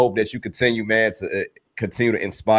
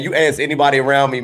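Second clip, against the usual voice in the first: ordinary delivery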